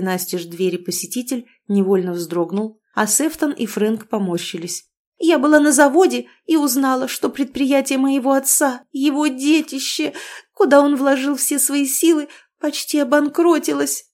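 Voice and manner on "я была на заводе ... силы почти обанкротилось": tearful lament. A narrator's reading. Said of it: "Всхлипнула".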